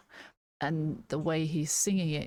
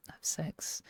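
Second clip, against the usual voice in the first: hushed whisper; 7 dB quieter than usual. Real, not acted. Explaining a lyric to viewers, whispered coyly. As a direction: whisper the two words under her breath. Reading lyric's hidden meaning aloud.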